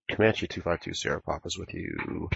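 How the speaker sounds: ordinary talk, nothing marked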